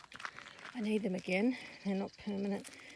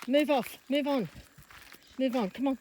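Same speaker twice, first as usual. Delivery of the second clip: firm commands; 4 semitones higher, 5 dB louder than usual. Real, not acted. Ordering horse to move away.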